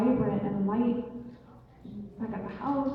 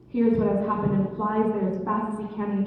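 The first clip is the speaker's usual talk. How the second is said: ordinary delivery, nothing marked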